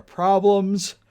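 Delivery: words pushed through a yawn; talking mid-yawn. Real, not acted. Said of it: "Man, I keep yawning".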